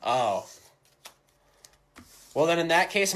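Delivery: ordinary talk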